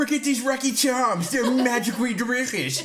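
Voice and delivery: put-on high, giggly cereal-slogan accent; botched Scottish accent. Spoofing a cereal advert for friends, botched Scottish accent. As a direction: pitch the failed accent high. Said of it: "doing my best Scottish accent".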